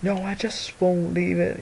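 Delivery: high, put-on singer's voice into a microphone. Showing how he talks into it, high mock singer voice. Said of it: "he talks like this into it".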